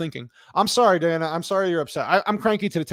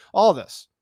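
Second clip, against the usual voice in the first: normal talking voice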